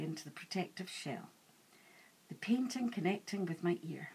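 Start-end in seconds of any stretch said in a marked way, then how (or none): none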